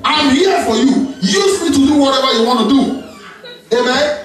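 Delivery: fervent high preaching; rising, impassioned sermon voice; preaching at full force. Real, not acted.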